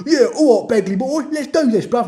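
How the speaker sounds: loud, high mock Jamaican accent that slips into London; badly faked Jamaican accent. Jamaican teammate agreeing to a mission, badly faked accent. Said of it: "four people on my team had Jamaican accents".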